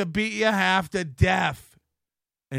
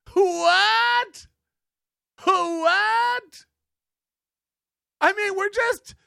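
much higher and louder, strained disbelief; incredulous what; 11 semitones higher, 5 dB louder, much slower than usual. Real, not acted. Streamer reacting to Kevin's denial, high incredulous shriek. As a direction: shriek it like you can't believe your ears.

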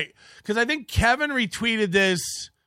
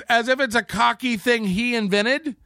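ordinary delivery

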